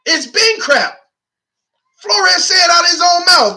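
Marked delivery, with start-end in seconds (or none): 2.0-3.6 fired-up rant. Real, not acted. Fan ranting about tanking claims.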